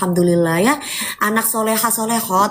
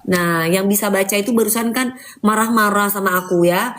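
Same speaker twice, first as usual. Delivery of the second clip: ordinary talk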